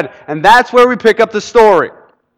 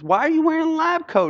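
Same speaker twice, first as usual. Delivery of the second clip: puzzled question; 10 semitones higher, 6 dB quieter than usual. Retold or imitated. Man asks the fake doctor.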